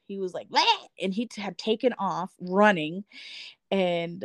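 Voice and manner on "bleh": high sharp yelp noise; mock dog yelp. Imitating the chihuahua's scared yelp. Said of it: "Jack had yelped".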